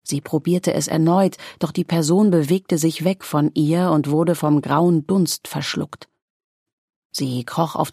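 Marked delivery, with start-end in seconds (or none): none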